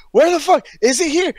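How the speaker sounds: high-pitched mock scream of disbelief; retold screaming. Fan retelling the lights going out, mock screaming. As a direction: re-enact a frantic high yell. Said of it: "screaming off the top of my lungs".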